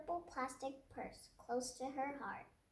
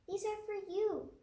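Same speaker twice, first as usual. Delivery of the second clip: put-on child voice; 8 semitones higher, 3 dB louder than usual. Lily speaking to teacher.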